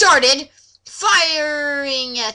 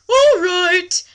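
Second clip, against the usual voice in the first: mock hearty Strider; 6 semitones higher, 5 dB louder than usual. Reading fanfic quotes in voices.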